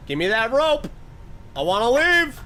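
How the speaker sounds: loud, high, rising demand; loud, impatient plea to get out; demanding yell. Real, not acted. Demanding to leave the game area.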